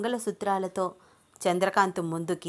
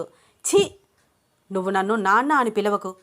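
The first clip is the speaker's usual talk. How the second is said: disgusted rejection; 5 dB louder than usual. Acted. Father rejecting his pleading daughter, disgusted. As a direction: spit out the first word, then forbid.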